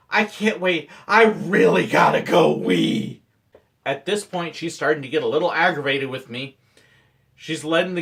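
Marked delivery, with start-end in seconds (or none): 1.1-3.2 slow, loud, strained pleading; hammed-up desperation, drawn out; mock desperate plea. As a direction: squeeze it out as if about to burst. Feigned urgency escalating, second plea.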